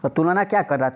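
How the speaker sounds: ordinary delivery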